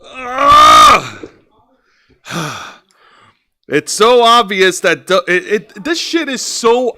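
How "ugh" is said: loud, high pained groan; frustrated groan. Real, not acted. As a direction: groan out in disgust.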